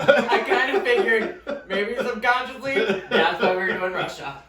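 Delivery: giggling through a sly joke; laughing. Real, not acted. Wife laughing at her own sly joke, giggling. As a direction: chuckle as you hint.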